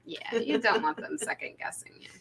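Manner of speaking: mock secret whisper; playful whisper. Casting director whispers a tip about hiding an accent.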